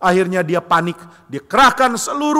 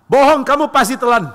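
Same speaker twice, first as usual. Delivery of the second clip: accusing; 8 semitones higher, 5 dB louder than usual. Acted. Wife accuses Cronus.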